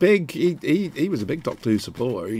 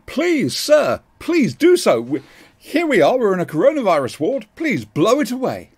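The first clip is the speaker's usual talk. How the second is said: mock pleading; 9 semitones higher, 4 dB louder than usual.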